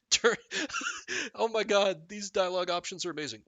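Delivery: ordinary talk, nothing marked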